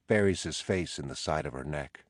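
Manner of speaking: ordinary delivery, nothing marked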